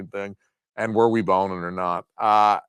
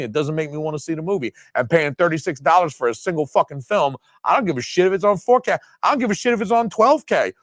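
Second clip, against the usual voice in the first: getting worked up about a thirty-six dollar disc; heated rant; 6 semitones higher than usual. Real, not acted. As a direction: blow up over the price. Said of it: "I'm getting a little heated".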